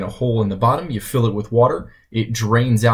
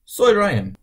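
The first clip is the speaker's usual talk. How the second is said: lucha libre announcer; 9 semitones higher than usual. Acted. Spanish-dub voice, high.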